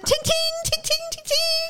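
high ringing ting ting of a bouncing spoon; spoon clattering noise. Retold or imitated. Mimicking the flung spoon clattering across the room. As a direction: ring out each ting like metal on the floor. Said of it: "and it's like".

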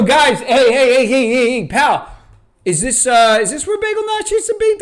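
high-pitched, insistent mock calling out; flagging down strangers. Acts out hailing strangers for directions.

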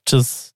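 "Chiz" for cheers, with no R and a short vowel; "Chiz", imitating a small child's cheers; toddler mispronunciation. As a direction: copy a toddler's "Chiz" as a toast. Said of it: "saying those words like she says".

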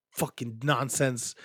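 ordinary delivery

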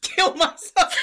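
blurted joke, breaking into high laughter; laughing. Real, not acted. To her friend's question, laughing at her own dark joke. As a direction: laugh it out right after the punchline. Joking answer, bursting out laughing.

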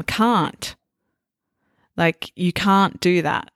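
"can't" with the AH of "father"; British long-A can't. Shown as a demonstration. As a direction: say "can't" as "kahnt". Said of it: "To me, that sounds very British".

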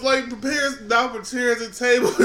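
ordinary delivery

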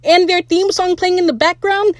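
high-pitched, gleeful celebrating; excited squeal. Retold or imitated. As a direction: squeal it like you just won. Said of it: "I squealed so hard".

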